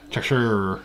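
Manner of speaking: American Cheshire, R drawn out at the end; hard American R on Cheshire; mock American accent. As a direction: imitate an American saying Cheshire. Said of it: "Watching an American saying Cheshire".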